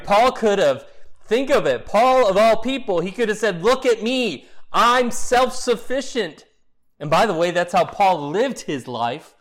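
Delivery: loud, high, driving preacher's climax; preaching at full force. Acted. Pastor to congregation, preaching at full force. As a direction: build the sermon to a ringing peak.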